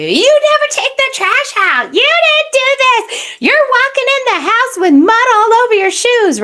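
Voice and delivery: high mock nagging wife voice; nagging partner. Woman lashing out at partner coming home, high nagging voice. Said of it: "she lashes out at you".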